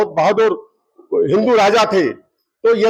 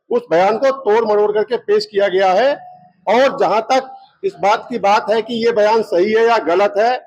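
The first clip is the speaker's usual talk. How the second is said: ordinary delivery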